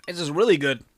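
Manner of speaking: normal talking voice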